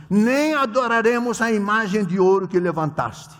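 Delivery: loud, high, fervent sermon reading; defiant preacher's declamation; preaching scripture. Acted. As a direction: proclaim it with full force to the king. Preacher reads Daniel's reply.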